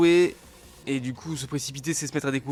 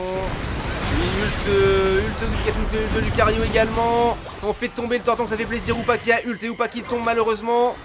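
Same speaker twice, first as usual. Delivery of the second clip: hyped, higher and louder play-by-play; excited; 7 semitones higher, 5 dB louder than usual. Real, not acted. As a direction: call the fight with rising hype. Caster calling a teamfight.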